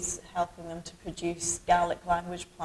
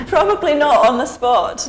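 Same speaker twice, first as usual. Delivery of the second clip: higher and louder, bubbling with laughter; laughing; 9 semitones higher, 16 dB louder than usual. Real, not acted. Guest laughing off naming every rain word, laughing loudly. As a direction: laugh it off, caught out.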